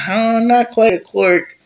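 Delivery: high and slow, hesitant; hesitant friend's voice. Acted. Hesitant friend revealing his new power, high and slow. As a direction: hold it back, unsure how to explain.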